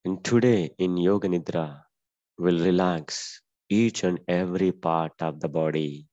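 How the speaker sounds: very slow, drawn-out instruction voice; slow guided meditation. Acted. Yoga teacher guiding the class into relaxation, very slow calm voice. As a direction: lead them gently into rest, word by word.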